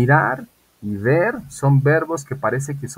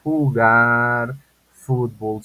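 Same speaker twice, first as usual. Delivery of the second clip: each word drawn out slowly and loudly; slow model sentence; 3 semitones higher, 8 dB louder, much slower than usual. Shown as a demonstration. Teacher dictating to students, very slow and louder. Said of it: "Como digo".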